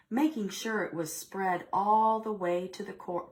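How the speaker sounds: ordinary delivery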